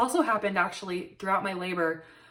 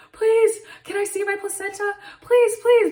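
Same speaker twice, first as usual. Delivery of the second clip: mock pleading; 12 semitones higher, 8 dB louder than usual. Begging staff to see placenta.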